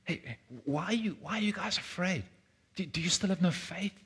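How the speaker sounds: soft, high, coaxing question; hushed, caring reassurance; tender fatherly tone. Acted. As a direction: gently ask as if comforting a small child. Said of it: "Was Jesus talking to them with that kind of tone?".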